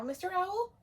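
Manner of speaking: ordinary talk, nothing marked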